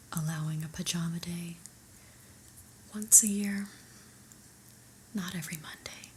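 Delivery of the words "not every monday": ordinary talk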